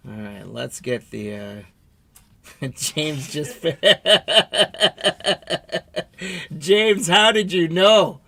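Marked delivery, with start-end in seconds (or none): 1.3-8.2 laughing. Real, not acted. Laughing at a lucky guess.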